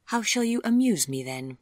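a lightly teasing Vivian; Vivian's voice. A narrator's reading. Vivian playfully asks Chip to entertain her.

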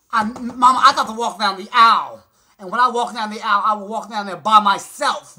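loud, grand declaration; belting out a proud boast; loud proclamation. Real, not acted. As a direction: announce it to the whole church. Proclaiming her grand aisle walk.

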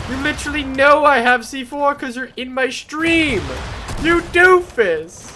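loud, slow drawn-out scold; mock scolding. Real, not acted. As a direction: scold him loudly. Scolding stream sniper loudly.

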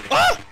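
very high, loud shriek of fright; startled scream. Real, not acted. Gamer shrieks as an enemy leaps out. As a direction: scream when it jumps you.

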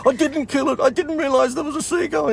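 high, flustered protest; a girl pleading she didn't mean it; defensive apprentice. Retold or imitated. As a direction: apprentice guide protests her innocence, high.

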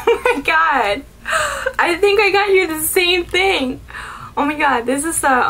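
high, loud, laughing disbelief; excited surprise. Real, not acted. Discovers they bought the same gift.

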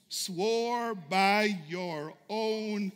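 very slow, stretched words; drawn-out emphasis. Acted. Moses holding God to his sworn word, drawn-out emphasis. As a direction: savour each word of the oath.

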